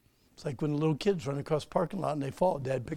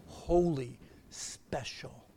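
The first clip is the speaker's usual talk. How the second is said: hushed whisper to the church; 4 semitones lower, much slower than usual. Real, not acted. Preacher hushing his voice over holiness, whispered. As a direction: let it drop to a reverent whisper.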